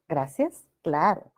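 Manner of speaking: ordinary delivery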